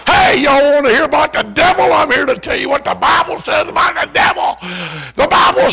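high, loud crowd-calling shout; hollering to the whole movie line; preacher shouting to the crowd outside the theater; street preaching shout. Retold or imitated. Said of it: "pulled that Bible out like he always did".

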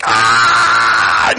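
short hammed-up scream of pain; preacher mimics a woman in labor crying out; mock labor cry. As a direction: imitate a mother's cry at the birth. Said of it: "she's like".

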